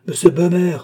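high, loud call up the stairs; loud, high call of the doorman from below. A narrator's reading. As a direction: announce the visitor, calling out from downstairs. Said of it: "cria d'en bas le Suisse".

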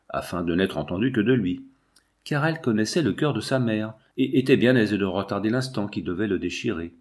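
usual speaking voice

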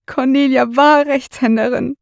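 acted grief, voice pushed up as the crying grows; tearful. A narrator's reading. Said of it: "sein Weinen wird lauter". (weinerlich, mit höherer Stimme)

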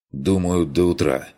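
ordinary delivery, nothing marked

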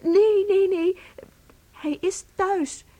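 high, loud hurried no's; anxious high insistence; flustered denial. Acted. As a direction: hastily correct him in alarm. Wife flustered denying hospital.

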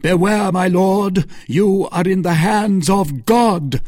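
high, ringing, ominous warning; loud warning. A narrator's reading. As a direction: warn him with fanatical fervor. Felton threatens the Duke.

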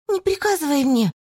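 high indignant exclamation; indignant cry. A narrator's reading. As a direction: snap back, high and offended. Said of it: "возмущённо воскликнула Мэлис". (возмущённый возглас)

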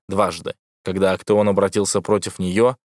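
usual speaking voice

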